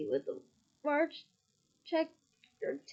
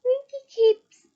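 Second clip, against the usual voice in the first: mutinous elf; 9 semitones higher, 13 dB louder, slower than usual. A narrator's reading.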